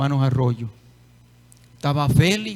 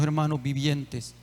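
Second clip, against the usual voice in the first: usual speaking voice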